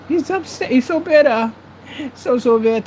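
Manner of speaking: high-pitched teasing mockery; exaggerated high taunt; mocking. Real, not acted. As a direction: make fun of him way up high. Mocking an angry rival.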